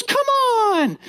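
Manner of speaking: put-on very high little-girl come on; toddler protest. Dad imitating his daughter echoing his protest, very high. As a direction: copy dad's complaint like a toddler. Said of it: "she goes".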